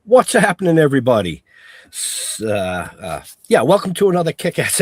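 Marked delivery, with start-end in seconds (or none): none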